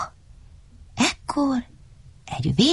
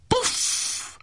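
puff; 5 dB quieter than usual. A narrator's reading. Tree falls on Dömdödöm.

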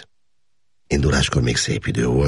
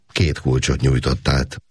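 ordinary delivery, nothing marked

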